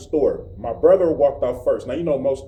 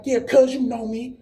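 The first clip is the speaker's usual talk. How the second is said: taunting; 8 semitones higher than usual. Retold or imitated. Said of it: "he was like".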